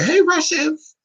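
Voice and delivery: high-pitched flirtatious hail; flirty call-out. Pretend. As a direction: call out coyly to an admirer. Calling out flirtatiously to Russians.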